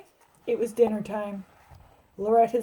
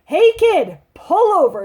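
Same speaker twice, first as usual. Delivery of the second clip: loud, high police shout; yelling policeman; 8 semitones higher, 10 dB louder than usual. Acted. Officer yelling at a speeding kid, loud shout. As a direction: yell it out the car window. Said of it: "rolled down the window and yelled".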